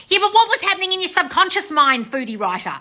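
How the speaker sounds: high, loud exasperated challenge; exasperated telling-off; losing it. Real, not acted. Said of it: "I'm losing my shit".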